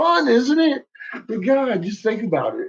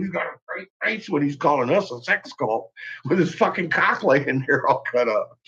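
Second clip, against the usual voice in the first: ordinary delivery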